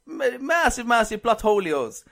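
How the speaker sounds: slow mock-Italian with an invented "holios" ending; overdone fake Italian, stretching "holios"; exaggerated Italian accent. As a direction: Italianise "holios" for comic effect. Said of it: "Well, in Italian accent".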